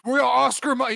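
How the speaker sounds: loud, high barked military callout; hammed-up soldier on a mission; mock soldier. Mocking military guy talk.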